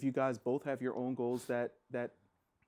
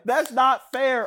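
slow, loud, higher complaint; drawn-out protest; 10 semitones higher, 12 dB louder, much slower than usual. Real, not acted. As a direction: stretch out the protest loudly. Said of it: "[name] was pissed".

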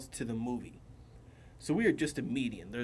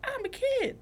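put-on squeaky, much higher child voice; little kid voice. Imitating the six-year-old girl's child voice. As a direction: pitch it way up like a kid. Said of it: "doing all of her little".

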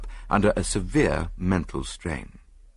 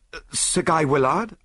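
cried out; 8 semitones higher, 3 dB louder than usual. A narrator's reading. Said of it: "I cried".

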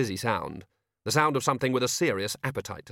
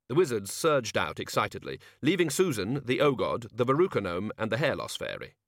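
plain reading voice

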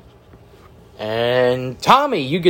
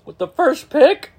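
surprised; 4 dB louder than usual. Real, not acted. Disbelief at Walmart pick.

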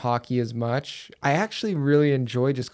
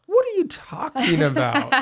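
much higher, laughing incredulous retort; mock disbelief; 12 semitones higher, 3 dB louder than usual. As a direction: act baffled by such a silly claim. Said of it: "I'm like".

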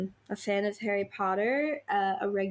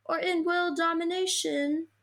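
lightly voiced professor, pitched up; McGonagall; 7 semitones higher than usual. Professor answering Harry about his plan, lightly put-on higher voice.

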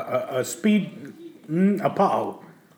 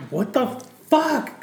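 shocked; 9 semitones higher, slower than usual. Retold or imitated. Driver's shocked reaction, retold.

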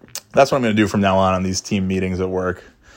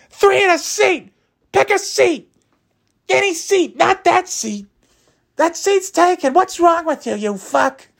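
shrill, much higher mock yell of an angry driver; screaming bus driver. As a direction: bark orders like a fed-up bus driver, way up high. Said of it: "the bus driver is screaming at me".

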